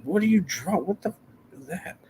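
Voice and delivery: high, trailing off to nothing; baffled, fading to a mutter. Retold or imitated. Dad baffled by a drawing, high then trailing off. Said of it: "I'm like".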